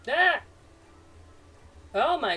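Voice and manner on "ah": sudden high, loud yelp of shock; sharp frightened cry; startled scream. Real, not acted. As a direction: scream as you're shoved. Shoved off the crane.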